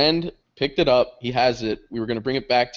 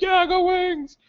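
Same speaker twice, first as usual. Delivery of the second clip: high-pitched mock fan whoop; rallying cheer, acted; slower than usual. Fan answering a drunk girl in the store, mock cheer. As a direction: cheer back as a fellow fan.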